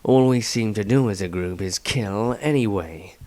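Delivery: low, slow voice, whispered in places; a weary killer writing by candlelight; grim brooding diarist. Acted. Brooding diary entry, unnamed writer.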